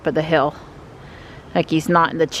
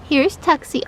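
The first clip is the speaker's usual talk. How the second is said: higher, sweet pet talk; 8 semitones higher, slower than usual. Real, not acted. Greeting the cat, higher coo.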